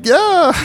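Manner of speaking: half-hearted high shriek; mock girlish scream. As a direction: imitate a little girl's squeal, held back. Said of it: "we were screaming like little girls".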